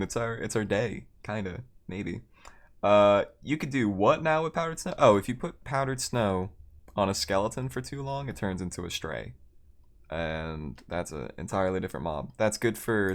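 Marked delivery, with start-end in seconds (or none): none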